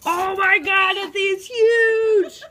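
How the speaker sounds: high, loud scream, dragged out on huge; shocked screaming. Real, not acted. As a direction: scream it in real alarm.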